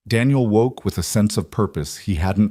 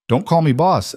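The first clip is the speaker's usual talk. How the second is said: light, smiling protest; 8 semitones higher than usual. A narrator's reading. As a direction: say it with a smile you can hear. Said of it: "hear the smile in her voice".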